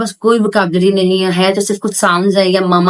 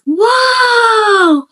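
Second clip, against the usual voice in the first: happy wow; 13 semitones higher than usual. Shown as a demonstration. Mother varying tone for a child, a delighted high wow. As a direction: burst with joy for a toddler. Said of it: "मैंने variation की".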